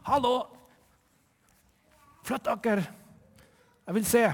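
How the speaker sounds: short man shouting to the crowd to move; calling out. Acted. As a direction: shout to be let through. Short man calls over crowd.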